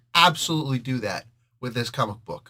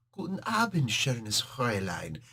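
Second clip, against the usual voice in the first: slow, softer German charmer; seductive German; 3 semitones lower, 6 dB quieter than usual. Retold or imitated. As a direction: purr it in German like a smooth seducer. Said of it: "it just sounds so sexy".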